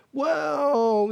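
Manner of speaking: high, drawn-out "well"; mock-naive long-winded opener; naive, eager answer. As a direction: stretch out a long, high "well" as if launching into a list.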